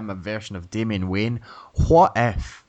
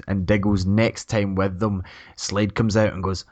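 usual speaking voice

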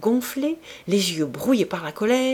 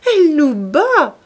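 higher, louder and slower gleeful cry; gleeful repeat, bigger; 9 semitones higher, 10 dB louder than usual. A narrator's reading. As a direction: lean harder into the mock alarm. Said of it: "répéta gaiement". (répéter gaiement, encore plus fort et plus lent)

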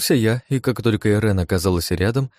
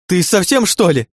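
high, loud indignant outburst; furious, raised-pitch protest; outraged; 11 semitones higher, 7 dB louder than usual. A narrator's reading. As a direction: yell at the one who threw you. Angry girl after being thrown.

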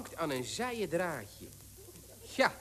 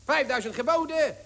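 quick rising auction patter, climbing higher; auctioneer; 4 semitones higher than usual. Acted. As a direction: rattle off bids like an auctioneer. Auctioneer calls rising bids.

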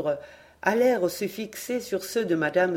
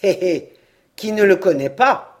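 louder mean snigger; a sailor sneering at the newcomer, louder; sneering snicker; 9 dB louder than usual. A narrator's reading. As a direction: snicker with malice. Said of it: "ricana Balanek, la voix sifflante".

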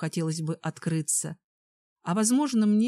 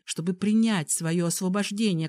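usual speaking voice

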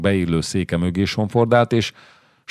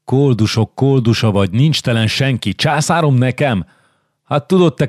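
mocking rasping duke; 3 semitones higher than usual. Visconti mocks Zsigmond, rasping and scornful. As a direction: sneer down at a beggar king. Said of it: "Viszkonti reszelős hangja volt".

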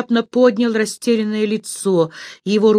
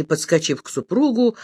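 ordinary delivery

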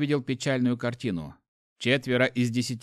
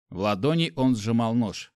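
usual speaking voice

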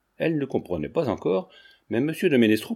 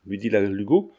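ordinary delivery

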